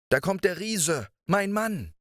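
high startled warning from the wife; alarmed, the giant is coming; wife hearing the giant at the door, a frightened high cry. Acted. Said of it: "rief die Frau erschrocken".

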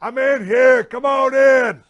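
high, loud shout from the shower; calling out. Retold or imitated. Stuck in the shower, shouting to the firemen at the door, loud call. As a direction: yell it so the firemen hear you through the house.

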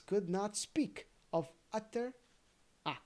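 ordinary delivery, nothing marked

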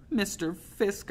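high, slow, small plea; small drawn-out pleading voice; begging. A narrator's reading. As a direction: beg him slowly. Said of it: "I begged, my voice as small".

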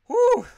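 very high, loud whew of disbelief; real stunned exhale; whistling whew. Real, not acted. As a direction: blow out a shrill whew at the amount. Reacting to a big donation.

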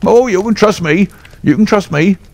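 a beaten bully grovelling to his master; frightened trainer fawning over Holmes; cringing trainer. Acted.

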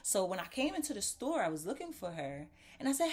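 usual speaking voice

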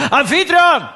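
loud, high yell of a name; shouted call. Retold or imitated. Visitor calls Zeus by his disguise's name, shouting. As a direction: holler the name to summon him. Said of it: "Ich schreie also".

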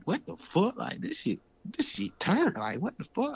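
high-pitched retold disbelief; astonished. Retelling first sight of the prison dorm, astonished. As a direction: let the voice leap up, incredulous.

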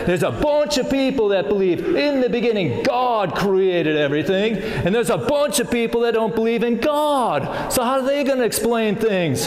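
high, hammed-up Aussie storyteller; Australian accent. Telling children a scientist's story at VBS, high Australian accent. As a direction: tell it big in a put-on Australian accent. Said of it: "we kept the Australian accent".

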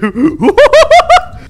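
very high, loud laughter; shrieking laugh. Real, not acted. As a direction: burst out laughing. Laughing at the boss.

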